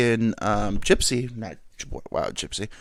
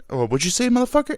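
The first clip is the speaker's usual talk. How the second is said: tough guy threat; 12 semitones higher, 3 dB louder, faster than usual. Pretend. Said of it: "The Rock will be like".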